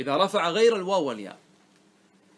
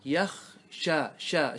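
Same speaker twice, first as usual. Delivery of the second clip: slow demo; much slower than usual. Shown as a demonstration. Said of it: "خشية".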